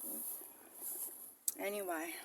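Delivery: slow hushed whisper; whispered under the breath. Real, not acted. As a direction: drop to a breathy aside. Aside while filming a find.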